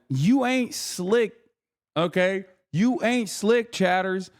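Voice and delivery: slow sing-song gloat; taunting. Acted. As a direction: stretch it out, teasing the chat. Teasing chat about ad break.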